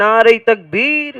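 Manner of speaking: slow, drawn-out shouted call of takbir; teacher showing the children how loudly to call takbir. Shown as a demonstration. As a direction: call it out to the crowd, stretching each word. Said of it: "ওই যে একটু জোরে বলতে হবে".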